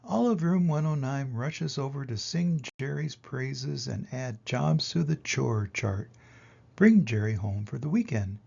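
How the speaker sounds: ordinary delivery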